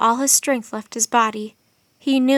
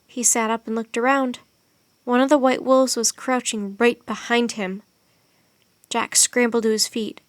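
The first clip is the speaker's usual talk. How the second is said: usual speaking voice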